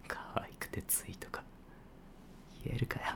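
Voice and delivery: soft, whispered aside he won't say aloud; whispered confession. Acted. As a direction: let the truth slip under your breath. 本音を小声で漏らす. (聞こえないように小声で本音を漏らす)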